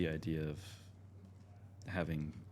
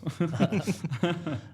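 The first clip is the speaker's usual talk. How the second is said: high, loud burst of real laughter; amused laugh; 10 semitones higher, 6 dB louder than usual. Real, not acted. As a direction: laugh at your own slip. Laughs at showing only the camera.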